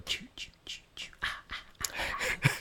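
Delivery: breathy whispered choo and ah; whispered horror score. Retold or imitated. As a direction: whisper the killer's theme. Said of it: "kids would go".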